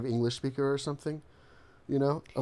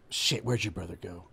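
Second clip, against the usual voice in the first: muttered aside; 6 semitones lower, 6 dB quieter than usual. Pretend. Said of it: "I'd be like".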